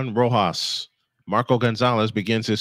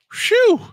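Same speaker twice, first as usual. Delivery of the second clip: relieved phew; 6 dB louder than usual. Real, not acted. Relief after escaping inning.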